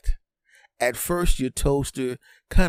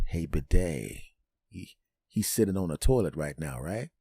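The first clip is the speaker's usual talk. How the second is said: conspiring sink; 5 semitones lower, 5 dB quieter than usual. Acted.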